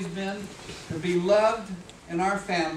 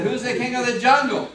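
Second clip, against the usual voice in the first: loud, raised-pitch boastful demand; insistent lion; 4 semitones higher, 9 dB louder than usual. Acted. Lion asking the elephant once more, insistent. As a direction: belt the challenge out higher and harder.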